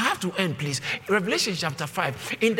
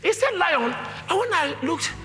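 preaching at full force; 10 semitones higher than usual. Acted. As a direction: cry it out to the congregation, rising to a roar. Preaching the vision of the lamb.

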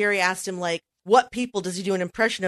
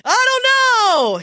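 much higher, slow, drawn-out; raised-voice Seinfeld; 5 dB louder, much slower than usual. Retold or imitated. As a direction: pitch it way up and stretch it out. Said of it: "raised his voice to, like, be more like Jimmy's impression".

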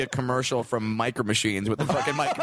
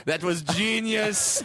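high, drawn-out pompous praise; playful grandiose boast; mock self-praise; 5 semitones higher, much slower than usual. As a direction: stretch it slowly, hugely pleased with yourself. Mock self-praise after joke.

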